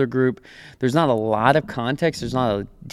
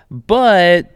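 a loud, higher "But" as the turn; abrupt turning "but"; 7 semitones higher, 6 dB louder than usual. Retold or imitated. Boss switching from praise to criticism, loud "but". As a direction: slam the brakes on the praise.

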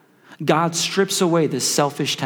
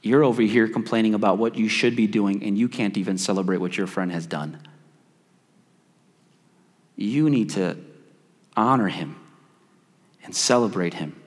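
gentle correction; 6 semitones lower, 3 dB quieter than usual. Retold or imitated. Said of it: "The Holy Spirit started talking to me".

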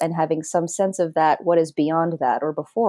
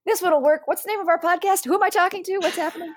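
confused self; 11 semitones higher, faster than usual. Retold or imitated. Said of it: "I'm like".

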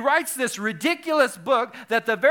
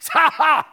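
loud, high imitated guffaw; Bob Goff laugh impression; 8 semitones higher, 11 dB louder than usual. Showing the congregation how he laughs, booming mock laugh. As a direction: bark out a big mock laugh. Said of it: "He laughs like that".

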